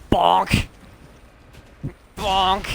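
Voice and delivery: gleeful high bonk sound effect; playful bonk. Pretend. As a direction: shout bonk like a cartoon hit. Bonking enemies in game.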